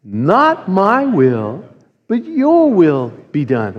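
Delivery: very slow, each word drawn out together; slow call and response. Shown as a demonstration. Preacher leading the church in a slow unison prayer. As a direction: lead the congregation through it slowly. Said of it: "Can we say that together?".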